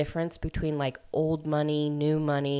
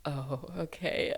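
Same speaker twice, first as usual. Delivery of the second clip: sheepish seatmate; 7 dB quieter, slower than usual. Retold or imitated. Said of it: "And he's like".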